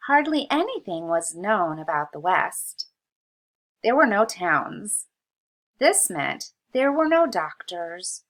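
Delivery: put-on reading aloud to children. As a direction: read it clearly so kids can follow. Reads textbook aloud to kids.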